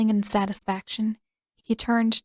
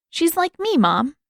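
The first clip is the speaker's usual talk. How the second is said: put-on high, louder eager boy; eager little boy; 9 semitones higher, 6 dB louder than usual. A narrator's reading. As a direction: convince mom excitedly. Boy pleading to mother.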